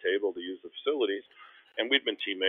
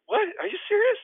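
very high, louder disbelief; incredulous; 5 dB louder than usual. Retold or imitated. To teammate pretending not to know him, incredulous. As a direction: squeak out a stunned question. Teammate again asks who he is.

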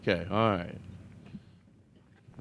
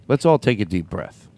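ordinary talk

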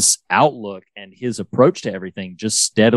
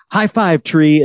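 cheerful call; 6 semitones higher, slower than usual. Retold or imitated. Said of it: "he says, like".

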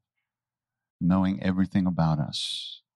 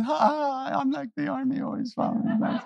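much higher, teasing voice; mocking echo. Maharaj teasing Dada with a high mocking echo. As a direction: toss the joke back in a squeaky tease. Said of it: "Maharaj goes".

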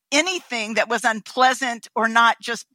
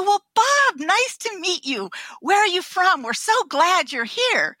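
overly cheerful welcome; 6 semitones higher than usual. Retold or imitated.

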